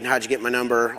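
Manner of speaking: normal talking voice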